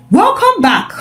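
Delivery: echoing voice. A narrator's reading. Sparrow greets bound Angela in a dim room, echoing. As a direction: let it ring like in a bare room. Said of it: "Sparrow's voice echoed".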